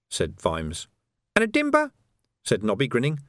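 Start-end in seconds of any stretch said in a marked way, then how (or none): 1.3-1.9 high, sly, wheedling urchin voice; high-pitched cockney urchin hustling a copper; cheeky street urchin. Acted. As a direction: haggle like a grubby street kid. Urchin Nobby's voice.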